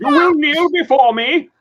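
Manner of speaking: booming, high-pitched villain command; imperious movie-villain shout; loud, commanding and hammed-up; General Zod. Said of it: "General Zod".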